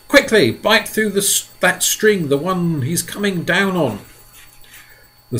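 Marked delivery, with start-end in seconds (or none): 0.1-4.0 high, urgent instruction; urgent James. A narrator's reading. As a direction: hurry the order. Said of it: "yelled James".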